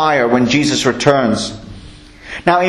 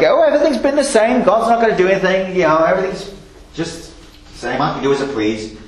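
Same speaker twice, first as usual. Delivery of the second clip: mocking scoffer; 2 semitones higher, faster than usual. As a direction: rattle off the scoffer's excuses, then trail away. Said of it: "lots and lots of scoffers walking around".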